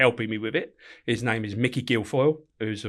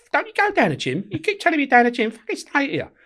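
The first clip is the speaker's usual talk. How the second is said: mocking wife; 3 dB louder than usual. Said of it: "And my missus says to me".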